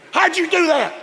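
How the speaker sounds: high, loud astonished question; amazed. Retold or imitated. Dad stunned that the kids are dressed, high amazed yelp. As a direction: marvel at the sudden miracle.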